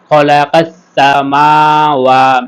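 MAA and WAA held long in সামাওয়া; এক আলিফ টান. Shown as a demonstration. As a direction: slowly recite সামাওয়া, stretching both long vowels. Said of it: "মাটা এক আলিফ টেনে পড়তে হবে". (সামাওয়া-র মা আর ওয়া টেনে পড়া)

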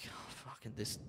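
a whispered aside, soft and low; whispered dread. Retold or imitated. Waiting at the hotel counter, whispered dread. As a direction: breathe it out in resignation.

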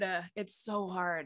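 quiet, defeated repeat; resigned murmur. Real, not acted. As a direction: let it drop softly, worn out.

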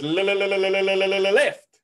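hammed-up stutter, sticking on the first sound. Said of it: "the man suffers from one incredible stutter".

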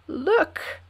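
high, loud, thrilled calling; excited shout. A narrator's reading. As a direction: call the king over, bursting with joy. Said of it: "he shouted to the king".

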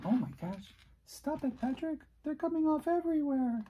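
very high, squeaky complaint at the barnacles; squeaky fretting. Real, not acted. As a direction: scold the crumbling barnacles in a tiny high voice. Barnacles falling off while painting.

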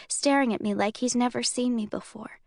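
plain reading voice

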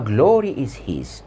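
ordinary talk

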